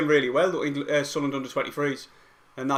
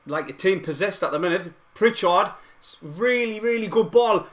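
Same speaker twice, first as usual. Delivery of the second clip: raised, excited football commentary; live play-by-play; 5 semitones higher, 5 dB louder than usual. Real, not acted. Fan streamer calling a long pass to Broadhead, excited play-by-play. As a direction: follow the ball, voice lifting with the chance.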